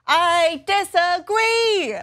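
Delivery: loud, high, drawn-out protest; slow, loud declaration of dissent; emphatic objection. Real, not acted. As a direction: stretch it out as a big, high objection. Rebuts the quoted explanation.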